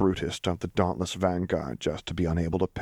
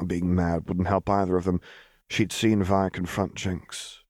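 plain reading voice

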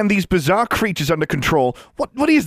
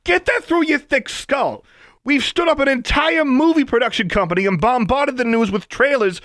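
high, loud ranting yell throughout; furious rant; 5 dB louder than usual. Acted. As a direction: rage at him without pause. Johnson ranting at governor.

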